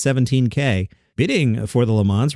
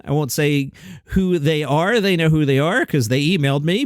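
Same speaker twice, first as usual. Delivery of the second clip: ordinary talk, nothing marked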